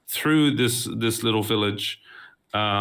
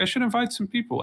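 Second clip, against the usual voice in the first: high, light musing to himself; first casual idea, pitched high; first thought; 12 semitones higher than usual. Retold or imitated. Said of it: "And then I, I went like".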